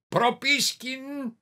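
high, loud, drawn-out call. A narrator's reading. Inquisitor searching the room for the hidden narrator, calling out. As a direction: call for someone in hiding, stretching the name. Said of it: "Στην αρχή φώναξε".

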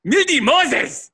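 high, drawn-out exclamation; exclamatory inflection. Shown as a demonstration. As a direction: show how to cry it out, slow and high. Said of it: "You have to have that inflection".

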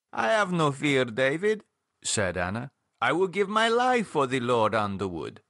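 a hint of a devout older woman; earnest older woman's voice, lightly done; Anna, lightly voiced. Anna backing David against the doctor, lightly voiced.

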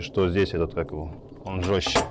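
usual speaking voice

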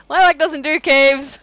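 laughing. Real, not acted. Laughing joke about pet.

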